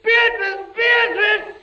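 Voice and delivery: high, loud anguished screams; screaming her name. Acted. Prisoner screaming for his vanished wife, high and loud.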